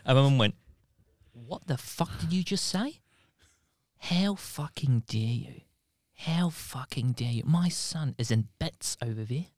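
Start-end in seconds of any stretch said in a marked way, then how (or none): 1.3-9.5 angry Kiwi mum. Retold or imitated. Mum tearing into the teacher, in a quiet, menacing Kiwi accent. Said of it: "when she gets angry, she turns into that, like ... Kiwi".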